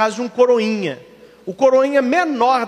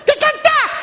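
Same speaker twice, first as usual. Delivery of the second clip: put-on insistent little boy; slower than usual. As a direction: demand it like a stubborn kid. Boy calling mother at night.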